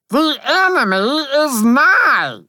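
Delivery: very high, loud piping shout; high heroic battle cry; shouted warning. A narrator's reading. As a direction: raise the alarm, stretching the last word. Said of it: "I shouted".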